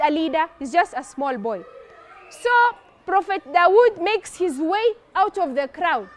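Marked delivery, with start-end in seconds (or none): none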